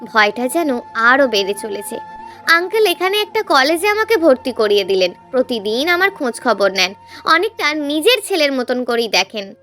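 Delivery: ordinary delivery, nothing marked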